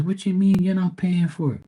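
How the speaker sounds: mom's startled high question; imitating mom. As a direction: mom asking in disbelief. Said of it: "She goes".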